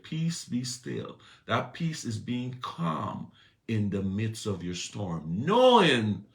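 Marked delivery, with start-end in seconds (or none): 0.0-1.2 slow calm command. Acted. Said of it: "He said". 5.5-6.3 loud emphatic knowing. Acted. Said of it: "emphatic repeated word".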